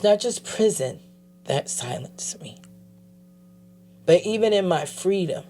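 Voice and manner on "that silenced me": unvoiced, hushed close; whisper. Real, not acted. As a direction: let it fall to a whisper.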